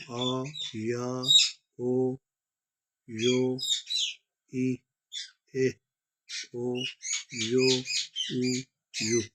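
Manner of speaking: drawn-out vowels, a pause after each; letter-by-letter recitation. Shown as a demonstration. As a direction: recite each vowel slowly and separately, like a teacher. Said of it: "یعنی اس طرح آپ پڑھیں گے".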